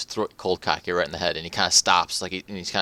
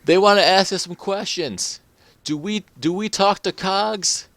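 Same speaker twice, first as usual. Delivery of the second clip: higher, slow call out to the crowd; rally the crowd against the cops, higher and slow; crowd member yelling; 13 semitones higher, 5 dB louder, slower than usual. Acted. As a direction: townsman rousing a crowd against police, a slow raised call. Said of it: "looks back at the crowd and yells".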